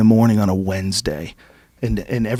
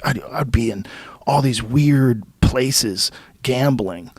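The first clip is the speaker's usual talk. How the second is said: ordinary talk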